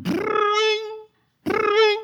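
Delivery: put-on high trilled brrring like an old phone; phone ring imitation. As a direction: ring it out like a telephone, high and rolled.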